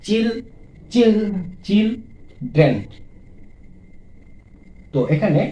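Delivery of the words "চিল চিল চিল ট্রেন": চিল repeated as a lone beat, then train; teacher drilling চিল, চিল, চিল, then train; isolated syllables. Shown as a demonstration. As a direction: sound out চিল and train with pauses between. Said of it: "দুইবারে উচ্চারিত হচ্ছে".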